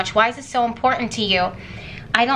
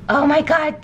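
higher, louder burst of real excitement; excited exclamation; 5 semitones higher, 4 dB louder than usual. Real, not acted. Reader tells viewers she feels the energy, excited outburst. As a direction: blurt it out, startled and thrilled.

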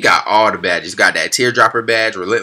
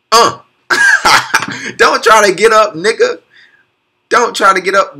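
higher and louder, laughing through it; laughing taunt; 10 semitones higher, 4 dB louder, slower than usual. Real, not acted. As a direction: tell the fallen man to stay down. Taunting fallen defender, laughing.

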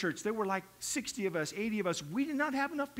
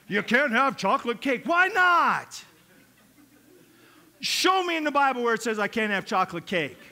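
louder, indignant back-and-forth; church quarrel; 8 dB louder than usual. Retold or imitated. Church members fight over chocolate cake, loud and indignant.

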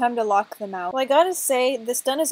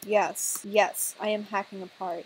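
normal talking voice

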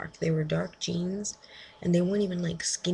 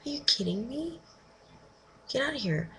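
incredulous; 6 semitones higher than usual. Real, not acted. Disbelief at stranger's line.

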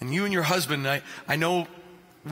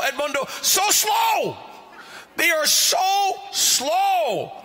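frustrated whine; 10 semitones higher, much slower than usual. Retold or imitated. Impatient American at a long French dinner, drawn-out whine. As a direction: moan it like waiting forever for dinner. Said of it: "I'm getting frustrated".

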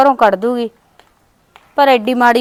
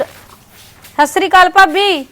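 drawn-out, higher, careful hello; timid slow greeting; 6 semitones higher, 3 dB louder, much slower than usual. Acted. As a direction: greet her slowly, hoping not to be snapped at. Timid greeting to hostile sister-in-law. (ਭਾਬੀ ਨੂੰ ਡਰਦੀ ਡਰਦੀ ਲੰਮੀ ਸਤਿ ਸ੍ਰੀ ਅਕਾਲ)

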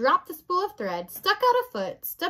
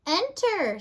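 high, lightly called summons; raised pitch, calling through a door; 6 semitones higher, 4 dB louder than usual. A narrator's reading. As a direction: call to whoever is knocking. Princess calls again through door.

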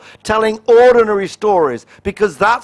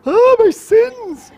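mock dismay; 13 semitones higher, slower than usual. Mocking reaction to preaching.

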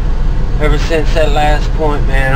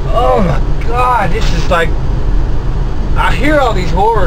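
exasperated venting; 8 semitones higher than usual. Real, not acted. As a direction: let it all out now he's gone. Alone now, venting freely.

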